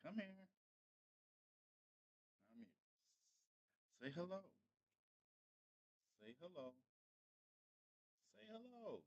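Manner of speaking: soft, mostly high-pitched coaxing; coaxing a pet. Real, not acted. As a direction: coax a shy pet very softly. Coaxing Bella to greet viewers.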